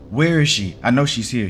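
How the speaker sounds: angry father shouting at the door. A narrator's reading. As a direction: burst out demanding to see her. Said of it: "he shouted at Ann".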